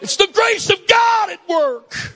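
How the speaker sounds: shouted refrain. Acted. Preacher repeating his point to the congregation, shouted. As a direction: proclaim it to the back row.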